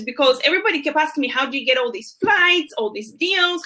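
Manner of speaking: ordinary talk, nothing marked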